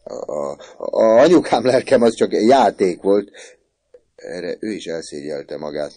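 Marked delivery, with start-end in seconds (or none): 0.0-3.3 stammering start, then high and loud; stumble, then plead loudly with mother; flustered pleading. A narrator's reading. Son explaining to his mother, flustered pleading. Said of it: "zavarba magyarázva".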